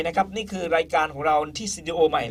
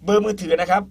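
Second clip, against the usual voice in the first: ordinary talk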